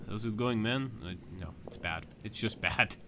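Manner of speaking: put-on deadpan monotone Finnish accent. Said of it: "I don't do a very good Finnish accent".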